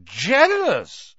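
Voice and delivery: high, loud drawn-out disbelief; jeering incredulous question; scornful echo. A narrator's reading. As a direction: stretch it out in mocking amazement. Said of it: "who didn't need to shout any more".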